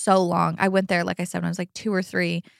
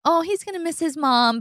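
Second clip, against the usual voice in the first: sweet nurse; 7 semitones higher than usual. Retold or imitated. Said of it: "trying to be sweet".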